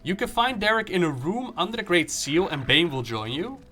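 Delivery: ordinary talk, nothing marked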